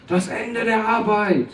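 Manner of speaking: high, slow, echoing announcement; reverb echo voice. Acted. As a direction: say it like it echoes through a hall. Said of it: "Soll ich den Hall noch ein bisschen hochdrehen?".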